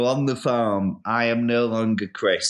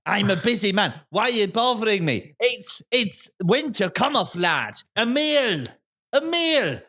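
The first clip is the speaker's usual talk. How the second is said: put-on higher, brisk farmer in an Irish-sounding accent; busy Irish-sounding farmer; 9 semitones higher than usual. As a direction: shoo the visitor off while barking farm chores in an Irish-sounding accent. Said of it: "what part of Ireland are you from".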